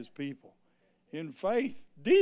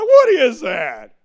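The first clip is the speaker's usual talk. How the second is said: put-on high, loud, slow kid's question; little kid; 7 semitones higher, 13 dB louder, slower than usual. Imitating a little kid asking about the song, high and loud. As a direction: blurt it like a puzzled little kid. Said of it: "it was a little kid".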